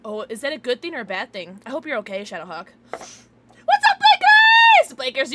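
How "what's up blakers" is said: very high, screamed hello; hyped greeting. Real, not acted. Streamer greeting a favourite viewer, hyped high scream.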